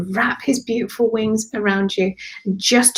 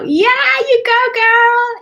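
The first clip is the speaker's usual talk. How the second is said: high, loud, drawn-out whoop of encouragement; excited cheer; 6 dB louder, slower than usual. Real, not acted. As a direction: cheer her on, pitched right up. Cheering on a viewer's comment.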